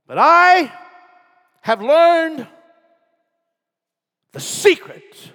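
high, loud cry on the key words; soaring shout. Acted. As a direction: lift the secret up like a revelation.

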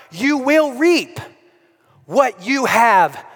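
climbing pulpit intensity, slowing on the last words; full-force preaching. Real, not acted. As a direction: drive it home to the congregation.

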